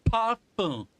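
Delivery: high, loud "Parfum" copying Canadian French; Canadian French imitation. Shown as a demonstration. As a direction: echo a Canadian French "Parfum", falling at the end. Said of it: "that's French in Canada".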